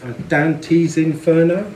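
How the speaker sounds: ordinary talk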